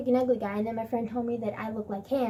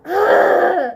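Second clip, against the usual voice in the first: loud, high mock groan; exasperated groan; 6 semitones higher, 14 dB louder than usual. Loud groan of mock despair. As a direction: groan out hammed-up frustration. Acts out frustration of bad timing.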